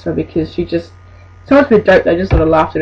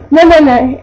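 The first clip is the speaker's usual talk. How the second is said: higher, louder fussy no no no; mock refusal; 6 semitones higher, 5 dB louder than usual. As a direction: wave the cashier off. Customer refusing to be served.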